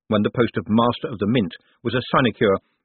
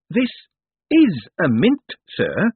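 put-on patient, slightly higher explaining to a child; talking to a child; 10 semitones higher, 4 dB louder, slower than usual. A narrator's reading. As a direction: spell out the obvious, patiently. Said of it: "like a man talking to a child".

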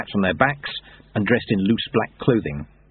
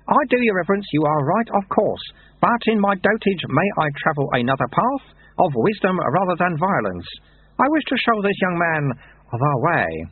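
lightly voiced wry old monk; dry, knowing old sweeper; old monk Lutze; 9 semitones higher than usual. A narrator's reading.

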